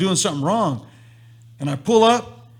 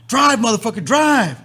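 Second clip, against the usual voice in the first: retold urgent shout; 6 semitones higher, 5 dB louder than usual. Retold friend ordering him to drive.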